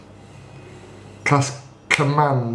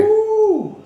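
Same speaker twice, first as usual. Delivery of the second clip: high, drawn-out "woo" of delight; excited whoop. Real, not acted. Player celebrating almost a max roll, gleeful whoop. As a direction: let out a long, high cheer. Cheers a near-maximum damage roll.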